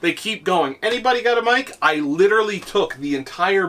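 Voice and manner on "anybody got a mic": put-on higher, mildly pestering kid; imitated gamer kid. Kid keeps asking teammates on Fortnite, imitated pestering. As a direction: lightly mimic a kid who keeps asking.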